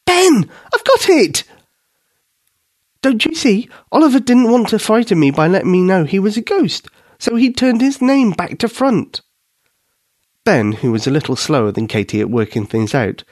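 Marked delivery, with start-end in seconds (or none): 0.0-1.4 much higher, louder, drawn-out cry of discovery; excited squeal. A narrator's reading. Katie suddenly solving the ghost puzzle, squealing high. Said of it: "she let out an enormous squeal". 3.0-9.2 higher, eager explaining voice; excited girl. A narrator's reading. Katie explaining the reversed name to Ben, excited.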